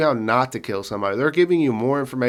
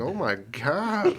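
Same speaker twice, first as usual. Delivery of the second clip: higher, drawn-out mock dismay; appalled disbelief; 5 semitones higher, much slower than usual. Husband retelling his reaction to wife's dark remark, mock dismay. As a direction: drag it out, appalled.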